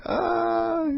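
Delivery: very high, held mock scream. Imitating a comic character running around, high-pitched scream. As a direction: squeal out one long high note. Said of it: "he's screaming the whole time".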